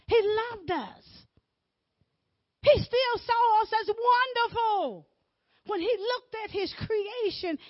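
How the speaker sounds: loud, high preacher's cry; rising sermon shout; full-force preaching. Acted. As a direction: preach it out with fire.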